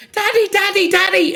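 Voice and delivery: put-on high, pleading child's voice; little girl. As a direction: call for Dad like a frightened child. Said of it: "a little girl runs up the stairs".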